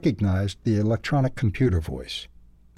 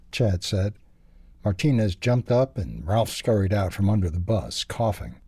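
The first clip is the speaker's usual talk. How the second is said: ordinary delivery, nothing marked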